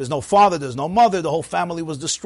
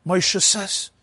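ordinary talk

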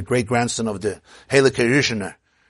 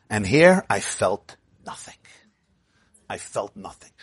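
hushed; 3 semitones lower than usual. A narrator's reading. Rebbe confides quietly.

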